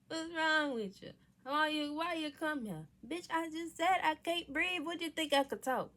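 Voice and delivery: high, loud sassy mimicry; mocking high voice. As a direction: mimic the nosy questions. Mocking nurses, then retort.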